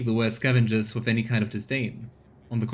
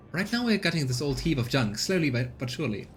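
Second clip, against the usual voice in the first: higher, lively woman's voice; colonist woman; 3 semitones higher than usual. Acted. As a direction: proudly explain the scavenger's life. Voicing Rita explaining job.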